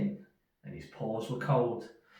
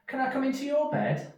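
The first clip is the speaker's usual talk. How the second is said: high, pleading little mouse; 5 dB louder than usual. Acted. Little Mouse asks Big Mouse to share his bed: high pleading voice. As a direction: beg sweetly in a tiny voice.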